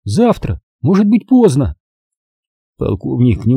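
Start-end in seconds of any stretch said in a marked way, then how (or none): 0.0-1.8 high, emphatic plea; high, insistent; girl pleading stubbornly, pitched up. Acted. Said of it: "упрямо посмотрела".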